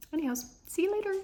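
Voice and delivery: bright fast sing-song bye to viewers; silly goodbye. Real, not acted. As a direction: toss off a cartoonish farewell.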